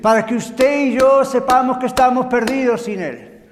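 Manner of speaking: emphatic preaching. Real, not acted. Preacher answering why God gave the law, emphatic. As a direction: press the answer home forcefully.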